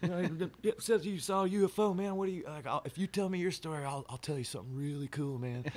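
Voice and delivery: put-on a friendly old guy's Arkansas accent. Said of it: "I'll do his Arkansas accent or Oklahoma accent".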